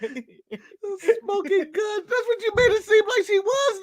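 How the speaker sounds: high, slow, words squeezed out through laughter; can barely speak for laughing; laughing at a friend's wrestling joke; laughing hard. Real, not acted.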